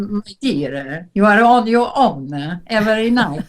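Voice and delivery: put-on charming Italian hotel owner's accent. Hotel owner inviting a lone guest to dinner, Italian accent. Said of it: "who's a very charming Italian".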